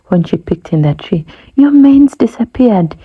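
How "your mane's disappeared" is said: high, loud astonished cry; astonished gasp. A narrator's reading. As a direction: gasp in shock at the bald lion. Hornbill sees bald lion.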